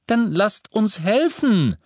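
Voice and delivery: high, slow, loud angry demand; furious. A narrator's reading. Neville retorts to his friends, furious. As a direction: insist angrily, stretching the words. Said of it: "erwiderte Neville wütend".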